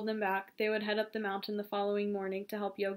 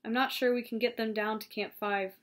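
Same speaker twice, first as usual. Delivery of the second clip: ordinary delivery, nothing marked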